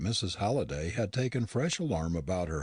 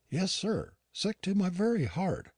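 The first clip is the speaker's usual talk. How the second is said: mournful; 11 semitones higher than usual. A narrator's reading. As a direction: sigh it as if heartsick, lightly. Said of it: "with a strange mournfulness in her voice".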